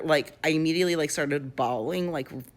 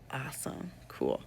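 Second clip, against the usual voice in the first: sarcastic deadpan; 2 semitones lower, 11 dB quieter than usual. Retold or imitated. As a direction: throw it away, totally unimpressed. Friends abandoned her, sarcastic reply.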